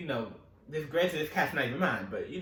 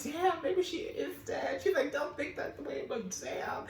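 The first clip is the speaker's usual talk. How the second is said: mock sister; 13 semitones higher than usual. Sister voiced, quoting.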